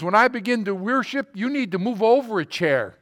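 imitating an excited, emphatic worshipper. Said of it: "You're really excited about this".

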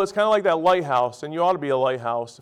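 ordinary talk, nothing marked

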